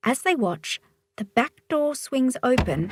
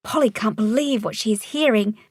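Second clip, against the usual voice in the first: plain reading voice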